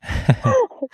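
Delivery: a burst of high giggling laughter. Real, not acted. Friend recalls her host mom, giggling happily. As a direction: laugh it out, delighted. Laugh after realizing love.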